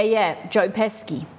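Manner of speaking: ordinary talk, nothing marked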